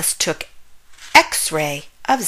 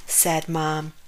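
plain reading voice

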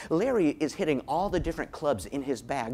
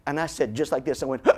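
ordinary talk

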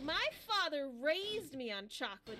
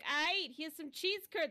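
put-on Brooklyn accent; 4 semitones higher, 5 dB louder, slower than usual. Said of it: "a guy from Brooklyn giving you cheese curds".

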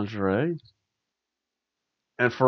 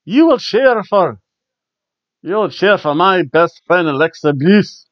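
higher, louder, slightly slowed mock Scottish accent; Scottish accent impression; 4 semitones higher, 4 dB louder than usual. Wrestler on the mic demanding cheers for her friend, mock Scottish accent. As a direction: lean into a hammed-up Scottish accent, pushing it up. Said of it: "I can't speak in a Scottish accent".

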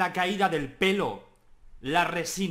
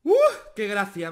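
normal talking voice

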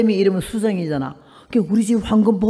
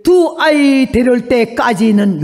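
loud, slow, booming divine command; voice of God like thunder; 3 semitones higher, 7 dB louder, slower than usual. Retold or imitated. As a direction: boom it out like thunder from heaven. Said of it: "병력 같은 소리가 귀에 들리기를". (천둥 같은 하나님의 음성, 크고 느리게)

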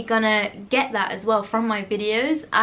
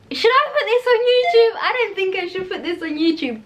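giggly; 10 semitones higher, 5 dB louder than usual. Real, not acted. Giggly hesitation before chipmunk trick.

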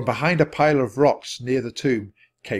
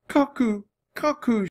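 high, mournful acted sob; tearful, high-pitched lament; sobbing; 13 semitones higher than usual. A narrator's reading. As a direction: moan the name as if in tears.

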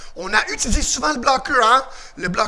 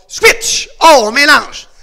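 loud, punchy coaching call; called-out command; 4 semitones higher, 10 dB louder, slower than usual. Real, not acted. Karate coach to pupils mid-drill, loud command. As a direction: bark it out to the class.

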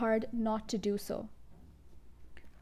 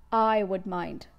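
a louder, curt snap from the father; the father snaps at Matilda's request; snapped; 3 semitones lower, 6 dB louder, slower than usual. A narrator's reading. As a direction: bite the words off sharply. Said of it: "he snapped".

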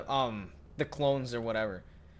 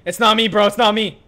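loud denial; 8 semitones higher, 14 dB louder than usual. Real, not acted. Denying being the impostor.

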